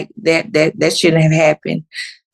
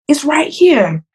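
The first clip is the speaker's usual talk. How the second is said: insistent; 9 semitones higher, slower than usual. Retold or imitated. Showing pump to staff.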